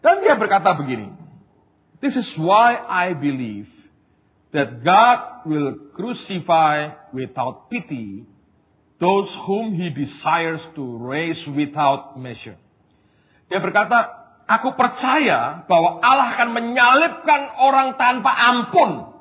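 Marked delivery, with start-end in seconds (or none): 2.0-12.5 slow, weighty English quotation; quotation reading. A narrator's reading. Preacher reads Tozer to congregation, slow solemn reading. 14.5-19.1 high, raised declaiming voice; emphatic declaration. Retold or imitated. Preacher translates Tozer for congregation, high emphatic declaration.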